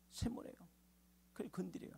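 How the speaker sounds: very soft, near-whispered aside; confiding, barely voiced; hushed. Real, not acted. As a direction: drop right down for effect. Preacher drops voice for effect.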